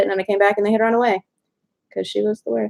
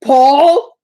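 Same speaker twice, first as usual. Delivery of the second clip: loud, high questioning call; startled recognition; 7 semitones higher, 8 dB louder than usual. Retold or imitated. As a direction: imitate a big friend's astonished call. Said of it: "he looks at my cat and he goes".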